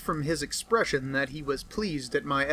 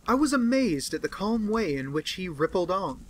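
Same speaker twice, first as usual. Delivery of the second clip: plain reading voice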